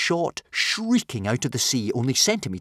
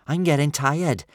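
higher, louder character complaint; tired complaint; 6 semitones higher, 5 dB louder than usual. A narrator's reading. Swimmer telling friends she is tiring, complaining. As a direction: complain in a raised young voice.

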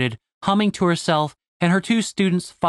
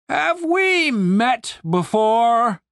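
very slow, higher-pitched suspicious question; suspicious commander stretching every word; bordering on accusatory; 8 semitones higher, much slower than usual. A narrator's reading. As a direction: draw it out, probing for a lie. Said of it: "His tone was bordering on accusatory".

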